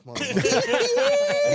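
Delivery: very high, loud, slow laughing yell; laughing shriek. Retold or imitated. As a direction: stretch the insult into a squeal. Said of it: "He said".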